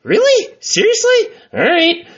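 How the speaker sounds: sudden high squeaks of disbelief; really can't believe it; disbelieving surprise. Retold or imitated. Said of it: "I'm like".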